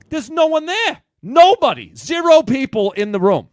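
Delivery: telling friends the room was empty, excited disbelief; amazed. Real, not acted. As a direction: blurt it out astonished. Empty audition room surprise.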